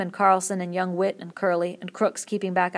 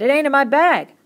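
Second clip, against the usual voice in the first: much higher and louder outcry; shout; 8 semitones higher, 12 dB louder than usual. A narrator's reading. Carlson telling the men his gun is gone, shouting. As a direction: bellow the news. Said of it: "he shouted".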